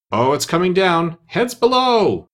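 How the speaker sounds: high, drawn-out warning call; warning cry. A narrator's reading. Workman warning those below of a falling slate, calling out. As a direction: call out as something falls, ending slow and high.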